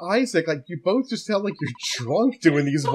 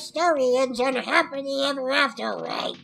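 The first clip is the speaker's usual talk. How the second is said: high, taunting villain voice, pitched way up; 11 semitones higher than usual. Acted. Voicing the game villain, high and taunting. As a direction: sneer the threat from up high.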